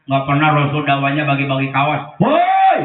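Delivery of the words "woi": mock shout. Mock Prophet calling crowd.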